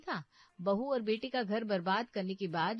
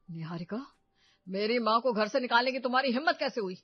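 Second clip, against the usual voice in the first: loud, furious demand at his wife; angry husband; 3 semitones higher, 9 dB louder than usual. Acted. Husband confronts wife over his mother, loud and furious. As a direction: blast her for throwing mother out.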